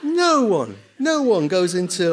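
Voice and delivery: loud, high-pitched incredulous protest; hammed-up indignant yell; comic outrage. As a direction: shout it in exasperated mock disbelief. Comic outrage at absurd restaurant behaviour.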